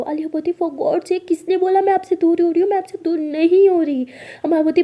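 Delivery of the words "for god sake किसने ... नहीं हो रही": high, raised-voice denial; exasperated. Acted. Alia denies drifting away from her partner, exasperated. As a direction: protest the charge, exasperated.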